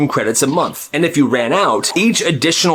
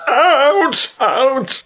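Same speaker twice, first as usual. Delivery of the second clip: high mock yelp; 11 semitones higher than usual. Security guard knocked over.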